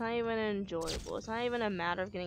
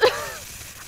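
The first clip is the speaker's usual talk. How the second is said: shocked laugh; 8 dB louder than usual. Real, not acted. Laughs after jumpscare death.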